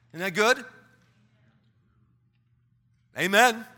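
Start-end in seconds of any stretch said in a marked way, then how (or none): none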